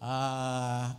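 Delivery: ordinary talk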